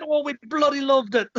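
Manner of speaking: put-on retelling an Irish fan's rave in an Irish accent; excited Irish accent. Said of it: "Pardon the accent".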